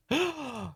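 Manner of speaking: high, sharp theatrical gasp; shocked gasp. Acted. Onlooker seeing sword pulled from hat, shocked gasp. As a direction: suck in air in mock horror.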